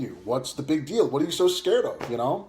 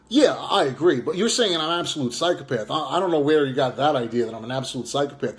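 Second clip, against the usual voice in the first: normal talking voice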